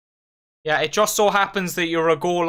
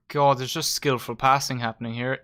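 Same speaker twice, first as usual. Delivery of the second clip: ordinary delivery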